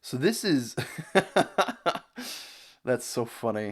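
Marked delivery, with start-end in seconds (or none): 0.8-2.5 a high, rising amused laugh; real laugh. Real, not acted. Reacting to the video's language statistics, amused laugh. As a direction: laugh at how funny it is.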